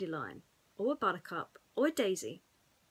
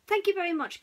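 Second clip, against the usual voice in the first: character voice; 10 semitones higher, 6 dB louder, faster than usual. A narrator's reading. Thanking Golfer.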